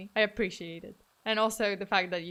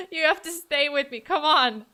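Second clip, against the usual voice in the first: loud, raised-pitch playful begging; mock pleading; 5 semitones higher, 6 dB louder than usual. Streamer begging viewers not to abandon her, mock pleading. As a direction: call out a melodramatic plea to stay.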